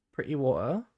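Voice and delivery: broad British "Pretty water" with both Ts as glottal stops; British pre'y wo'uh, rising at the end. Pretend. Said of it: "in the most British way I could".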